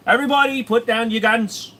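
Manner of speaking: mock Aussie shouting to everyone to drop their guns; shouted command. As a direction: bellow it like an order to the crowd. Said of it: "They're like".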